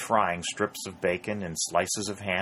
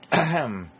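a sharp, higher throat-clearing ahem; loud ahem; 7 semitones higher, 4 dB louder than usual. Acted. Harry interrupts the chess-playing colonels with a loud, higher ahem. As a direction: clear the throat loudly to get attention. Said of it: "said Harry loudly".

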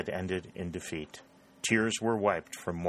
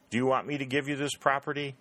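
mock indignant; 5 semitones higher, faster than usual. A narrator's reading.

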